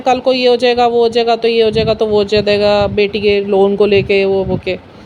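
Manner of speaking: droning list, pitch steadily sinking and trailing off; imitated worried rambling. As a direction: mimic a husband going on and on, slowing at the end. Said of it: "बोले जा रहे हैं". (पति की नकल में लगातार बड़बड़ाते जाना)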